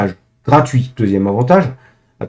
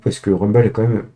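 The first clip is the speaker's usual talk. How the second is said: usual speaking voice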